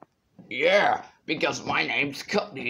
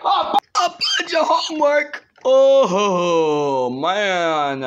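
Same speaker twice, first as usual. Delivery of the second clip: shocked outburst; 3 semitones higher, 8 dB louder, slower than usual. Pretend. Shocked loud at homework.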